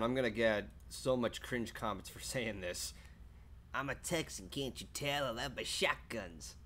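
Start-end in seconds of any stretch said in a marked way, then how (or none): 3.7-6.6 a hint of a Southern belle; southern accent. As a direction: quote her in a mild Texas accent. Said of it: "to quote her".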